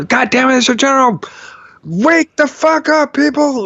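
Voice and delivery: high, hammed-up drill-sergeant yell; shouting like a general. To the sleeping vets, a general's high shout. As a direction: bark it like a general waking his troops. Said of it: "Shout at them like a general".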